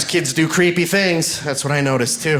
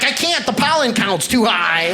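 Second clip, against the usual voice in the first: whiny wimp; 7 semitones higher than usual. Retold or imitated. Comic refusing a bro's fight, high whiny excuse. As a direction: whine it like a wimp.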